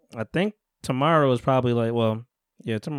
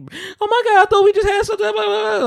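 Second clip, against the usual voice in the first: much higher, louder falsetto whine; mock whiny heartbreak; 4 dB louder than usual. Host mimicking the rejected woman, high hammed-up whine. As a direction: imitate her crying out in a high whine.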